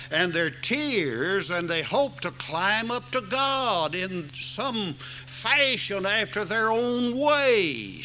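preaching. Acted. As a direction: preach it to the congregation. Preaching the way to God.

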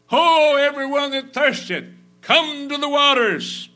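loud, high preacher's cry; loud, high scripture cry; ringing proclamation. Real, not acted. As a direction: call it out across the room. Scripture proclaimed at full force.

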